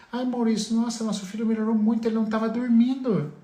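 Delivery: high, quick, excited parent voice; pais empolgados. Acted. Pais voltam contando a melhora. (imitando pais empolgados, voz bem aguda)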